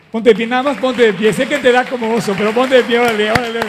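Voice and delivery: loud, high coaxing call to stand; urging call. Real, not acted. Pastor urging his friend's daughter to stand, loud and high. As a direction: cheer her up onto her feet, loudly.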